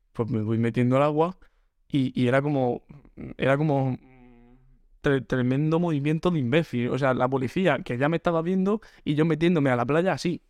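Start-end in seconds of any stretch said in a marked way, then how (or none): none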